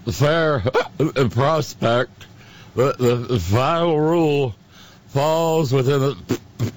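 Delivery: put-on slurred, silly drunk voice; silly drunken voice. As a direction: read it like a tipsy drunk. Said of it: "without the silly drunken voice".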